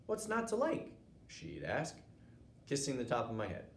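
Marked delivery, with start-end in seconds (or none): 0.1-0.9 bright, high affectionate mother; mom voice. Acted. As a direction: tease him fondly like a mom. Mom teasing son.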